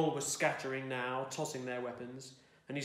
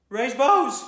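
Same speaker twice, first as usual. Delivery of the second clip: higher, loud spluttered command; spluttered order; 11 semitones higher, 18 dB louder than usual. A narrator's reading. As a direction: blurt the order out in a panic, loud and high. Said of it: "he spluttered".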